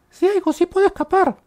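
very high, squeaky pretend voice; Bunny. Player answers own question as the game character, squeaky voice. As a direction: answer in a tiny falsetto. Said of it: "Bunny, ¿puedes escapar por fin, Bunny?".

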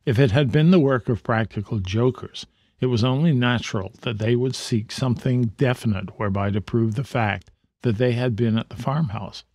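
usual speaking voice

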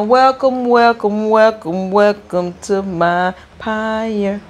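a second, bigger take on the royal welcome; grander welcome. Pretend. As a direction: roll out the welcome like royalty greeting her court. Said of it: "Let me say now".

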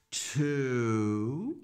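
drawn-out count, sliding upward; long TWO inviting children to join; slow counting. Pretend. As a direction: stretch TWO and let it climb.

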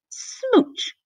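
high, squeaky kiss word; cutesy high-pitched sound effect; playful smooch. A narrator's reading. Sound of kissing the pumpkin.

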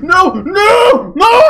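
high, loud cries of refusal; panicked screaming. Real, not acted. Player scared of heights in mid-flight, panicked screams. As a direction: scream no as you plunge.

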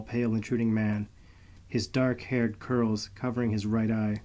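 plain reading voice

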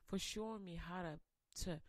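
closing prayer. Acted. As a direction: pray quietly, as if alone with God. Pastor leads a closing prayer.

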